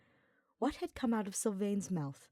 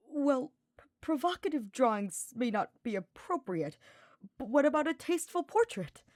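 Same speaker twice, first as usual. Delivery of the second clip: higher, halting and nervous; hesitant stammer; 7 semitones higher, 4 dB louder than usual. Acted. Nervous sniper stammering a suggestion at a glowering Hubert. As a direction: stumble over the first word, then push on. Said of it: "Ignatz continued hesitantly".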